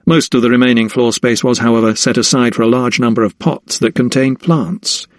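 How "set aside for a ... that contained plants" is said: usual speaking voice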